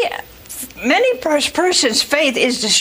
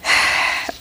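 long voiceless sigh; heavy sigh. Acted. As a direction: let out a long breath. Sigh before telling of Sarah's jealousy.

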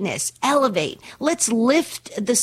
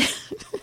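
soft unvoiced huff of laughter; quick airy laugh; breathless laugh; 13 dB quieter than usual. Real, not acted. Host laughing at her own pun, soft breathy laugh. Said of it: "laugh all day long".

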